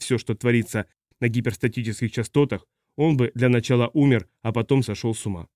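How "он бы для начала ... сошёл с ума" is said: plain reading voice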